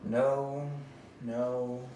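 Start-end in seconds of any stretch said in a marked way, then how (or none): none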